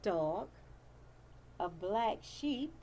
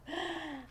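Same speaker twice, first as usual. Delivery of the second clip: gasp; 7 semitones higher, 7 dB quieter than usual. Acted. Page turn suspense.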